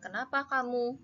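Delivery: ordinary talk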